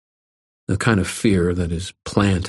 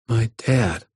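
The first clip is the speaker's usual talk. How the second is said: higher-pitched, wistful ache; a boy's understated longing; aching; 4 semitones higher than usual. A narrator's reading. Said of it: "I heard the ache in his voice".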